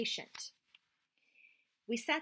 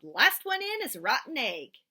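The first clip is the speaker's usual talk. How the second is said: louder playful yell; boy yelling challenge before jumping in; 5 semitones higher, 8 dB louder than usual. Acted. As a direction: shout it as a dare.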